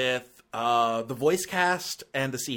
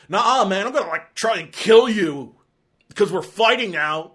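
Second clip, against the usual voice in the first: mock belligerent Baloo; 5 semitones higher, 8 dB louder than usual. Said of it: "So for here to just be like".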